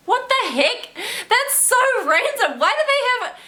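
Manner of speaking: high, disbelieving outburst; incredulous. Real, not acted. Vlogger baffled by paying for beach loungers, high and incredulous. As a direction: pitch it way up in disbelief.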